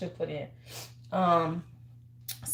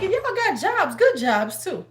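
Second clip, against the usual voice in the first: exasperated rant; 5 semitones higher, 6 dB louder than usual. Retold or imitated. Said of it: "I'm like".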